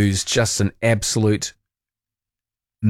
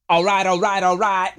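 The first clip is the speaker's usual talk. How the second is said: put-on Matthew McConaughey accent; 9 semitones higher than usual. Host answering a texter, McConaughey Texan accent. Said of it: "you can do a Matthew McConaughey accent".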